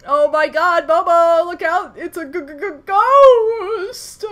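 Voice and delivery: loud, high mock terror with a cry; hammed-up panic. Streamer warning her dog about a ghost, mock screaming. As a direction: ham up the ghost scare.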